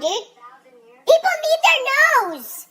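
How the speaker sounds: high, slow, loud outburst; exasperated yell. Real, not acted. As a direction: drag it out in exasperation. Venting at the doctor.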